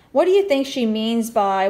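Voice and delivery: plain reading voice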